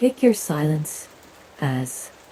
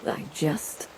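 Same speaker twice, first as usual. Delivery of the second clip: faltering whisper; 8 dB quieter than usual. Acted. Faltering, can't finish the thought.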